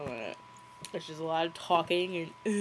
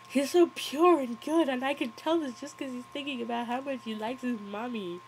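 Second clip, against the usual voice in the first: higher, mock-adoring dad; lightly mocked gushing; 6 semitones higher, 3 dB louder than usual. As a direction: gush about the baby, half-mocking. [name] gushing over baby's thoughts.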